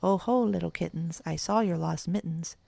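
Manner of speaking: ordinary delivery, nothing marked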